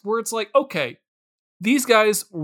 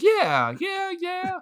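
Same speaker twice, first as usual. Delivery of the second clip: high, light voice admitting a mistake; singsong high shrug of a voice; mock sheepish admission; 9 semitones higher than usual. Mocking the group admitting the bad choice, high and sheepish.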